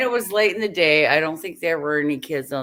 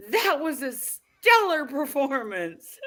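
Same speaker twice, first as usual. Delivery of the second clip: slow, much higher, laughing through the words; 10 semitones higher, slower than usual. Retold or imitated. Friend teasing the driver, slow laughing praise. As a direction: tease her while still laughing. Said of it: "She goes".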